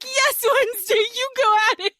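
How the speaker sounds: high-pitched, delighted egging-on; excited cheering. Retold or imitated. Viewer rooting for Wednesday against Amanda, excited cheering. Retold cheering on a film character.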